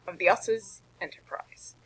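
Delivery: whisper. Acted. Captain introducing himself.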